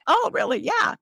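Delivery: mock surprise. Casting people surprised by her.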